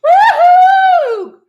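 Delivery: very high, loud party whoop. Acted. Character cheering about the party, high whoop. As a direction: let out a giddy cheer.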